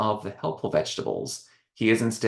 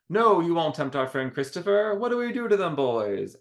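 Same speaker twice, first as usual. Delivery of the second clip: high, rallying heroic clown; rally the troops like a clown; 9 semitones higher than usual. Acted. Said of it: "Rococo, you know, responds".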